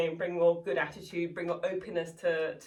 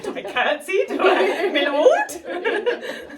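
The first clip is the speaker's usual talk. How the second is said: flustered mock servant; 12 semitones higher, 10 dB louder, much slower than usual. Imitating flustered servant meeting duke.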